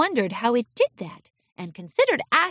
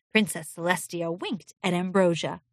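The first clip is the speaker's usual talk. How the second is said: ordinary delivery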